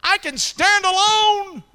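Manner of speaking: high, loud, slow bragging; boast. Acted. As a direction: puff up with false confidence. Peter's boast acted.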